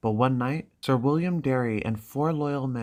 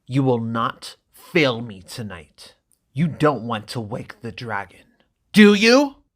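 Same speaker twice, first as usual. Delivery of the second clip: slow menacing brother, louder at the end; menacing threat; 9 dB louder than usual. Acted. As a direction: threaten her, then snap the last words. Viserys threatening Dany.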